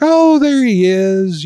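high, drawn-out chirpy greeting; happy and chipper. Retold or imitated. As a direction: imitate a cheery nurse cooing hello. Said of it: "she's all happy and chipper".

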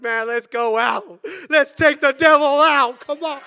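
loud, high shout to charge; rallying battle cry. Acted. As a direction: holler it like rousing troops to fight. Acts out rallying believers to fight.

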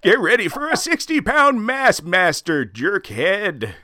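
high, drawn-out curmudgeon voice; Jack. Shown as a demonstration. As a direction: stretch out the insult in a high cranky voice. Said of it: "without thinking of Jeff's voice".

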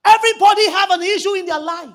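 loud, high proclamation; preacher booming a truth over the crowd; preaching at full force. Acted.

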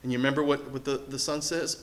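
normal talking voice

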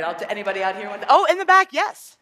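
ordinary talk, nothing marked

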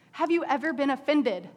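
ordinary talk, nothing marked

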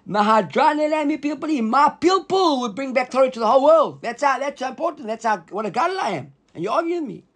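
high-pitched, indignant boasting; incredulous, self-important protest, pitched well up; immodest Rabbi Chanina. Acted. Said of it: "this doesn't sound very modest".

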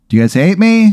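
high, climbing voice to a silent crowd; pleading question. Acted. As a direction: plead upward for some reaction. Acting out comedian begging silent crowd.

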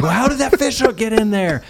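high, loud mock shock from the crowd. Youth group kids react to the hidden fish hook, in high mock shock. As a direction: gasp it out like a stunned kid finding a hook. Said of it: "They're like".

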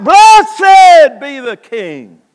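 loud, high, very drawn-out acclamation; crying out. Acted. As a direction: hail the king at full voice. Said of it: "they're crying out".

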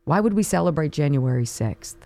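ordinary talk

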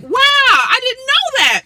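high, drawn-out wow of surprise; retold amazement. Wife retells learning of the Storm marriage, amazed. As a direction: relive being amazed, pitch shooting up.

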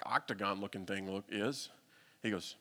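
ordinary talk, nothing marked

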